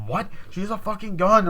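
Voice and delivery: loud, high mock alarm; alarmed outburst. Girl warning the others of a gun, loud alarm. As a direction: raise the alarm, acted out. Acted quarrel over the gun.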